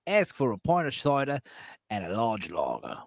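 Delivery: a passable British pub accent, lightly put on; light mock British accent. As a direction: order like a London local in a British accent. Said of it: "That's not bad".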